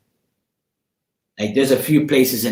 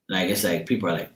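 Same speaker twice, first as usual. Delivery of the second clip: ordinary delivery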